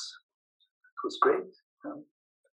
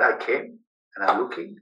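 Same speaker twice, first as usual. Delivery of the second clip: ordinary talk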